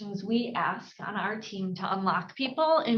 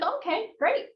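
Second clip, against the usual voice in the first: upbeat okay great; 10 semitones higher than usual. Shown as a demonstration. Agent's upbeat reply in role-play.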